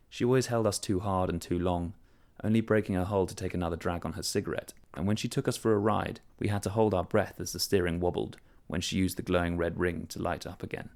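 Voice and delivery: ordinary delivery, nothing marked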